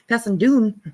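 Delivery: playful attempt at a Scottish accent. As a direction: say "down" as "doon", Scottish style. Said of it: "I'm horrible at it".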